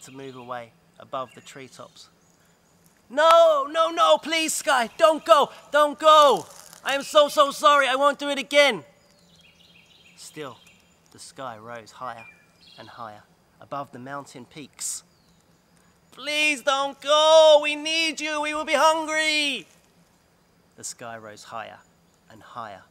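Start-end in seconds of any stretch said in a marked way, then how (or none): 3.1-8.8 high, loud frantic plea; girl crying out loud after the departing sky; desperate pleading. Acted. 16.1-19.7 high, loud and slow plea; girl begging the sky, high, loud and slow; anguished pleading. Acted. Girl begs the rising sky.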